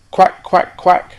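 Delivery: loud, high quacks; duck quacking. Acted. Duck greets the rocket.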